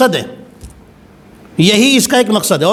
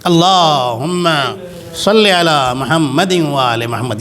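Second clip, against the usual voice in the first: salawat recitation; 4 semitones lower, slower than usual. Real, not acted. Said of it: "صلوات پڑھ لیں".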